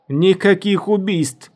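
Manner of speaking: loud, high, drawn-out command; high, forceful shouted ban; calling from afar. A narrator's reading. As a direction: call it out slowly across a distance. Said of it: "раздался голос Шелминат с большого расстояния".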